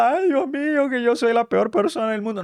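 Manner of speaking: high-pitched, hammed-up wailing self-pity; mock crying. Fallen believer crying on the floor, mock wailing. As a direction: wail it out in exaggerated despair. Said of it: "No te quedes en el suelo llorando".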